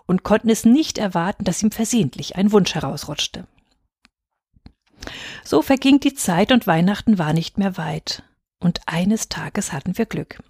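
ordinary delivery

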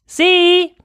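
a loud high call across the water; loud, high hail "See!"; shout. Acted. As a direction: call out as loud as you can to a distant boat. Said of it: "schrie ich".

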